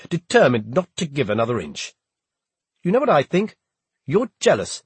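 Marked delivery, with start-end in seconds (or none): 2.8-4.8 higher, louder accusing voice; angry accusation. A narrator's reading. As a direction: throw the accusation in his face. Confronting Hawke in quarrel.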